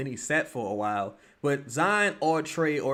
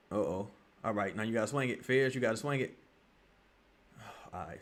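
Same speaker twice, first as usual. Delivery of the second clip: normal talking voice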